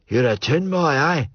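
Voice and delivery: lightly broad Scots questioning; a hint of an old Scots hillwalker; Scottish old man.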